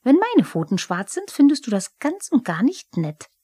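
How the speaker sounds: a huffy little goblin scoffing at the cat; Pumuckl the kobold. Acted. Pumuckl streitet mit Eder.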